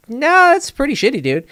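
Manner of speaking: high, loud protest; indignant objection. Retold or imitated. Storyteller retelling his answer to the teammate, indignant. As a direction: push back hard, voice jumping way up. Insisting to teammate it was shitty.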